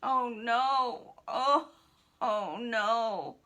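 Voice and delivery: loud acted groans of woe; moaning. Fallen man to worried friend, moaning loudly. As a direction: groan it out, broken by pauses. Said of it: "continued, moaning".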